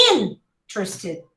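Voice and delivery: "interested" with IN held, the rest swallowed; stressed first syllable. Shown as a demonstration. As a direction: punch IN, then mumble-quick trs-tid in "interested". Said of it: "the rest you swallow".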